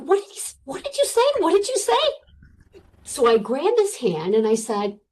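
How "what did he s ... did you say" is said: much higher, stretched-out "What did you say?"; stunned disbelief. Retold or imitated. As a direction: everyone gaping at the news. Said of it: "they're like".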